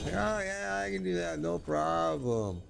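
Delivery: mock confidence. Man imitating himself assuring his wife, mock confident. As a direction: toss it off as an easy mock boast. Mimics his own overconfidence.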